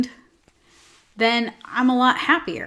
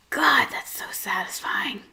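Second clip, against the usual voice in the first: hushed delight; 4 dB quieter than usual. Retold or imitated. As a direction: whisper it, savouring the pleasure. Voices own delight at grooming videos.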